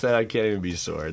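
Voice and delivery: ordinary delivery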